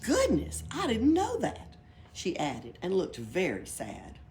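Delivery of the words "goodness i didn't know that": much higher, louder surprise; genuinely taken aback; high astonished gasp of words. A narrator's reading. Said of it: "much astonished".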